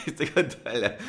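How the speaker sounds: laughing through the words; amused, losing the thread in laughter. Real, not acted. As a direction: break into a laugh mid-sentence. Breaks up at own digression.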